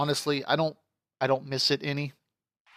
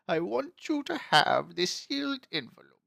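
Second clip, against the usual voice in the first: a lightly voiced old grandpa, higher; dying grandfather to his grandson, lightly voiced old man; 7 semitones higher than usual. Reading grandpa's dying words.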